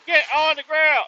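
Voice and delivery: high, loud barked order; mock police command. Mocking the guard's command at gunpoint, high loud bark. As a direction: bark it like a cop. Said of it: "you were like".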